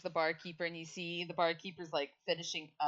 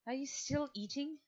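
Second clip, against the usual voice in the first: a higher, disbelieving "still eating"; incredulous question; 9 semitones higher than usual. Acted. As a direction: ask it in amused disbelief. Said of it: "she's just like".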